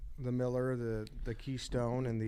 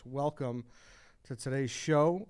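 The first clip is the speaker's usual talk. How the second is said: usual speaking voice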